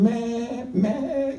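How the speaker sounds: high bleating "me, me" with a laugh; sheep bleat. Retold or imitated. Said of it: "y llorando las ovejas".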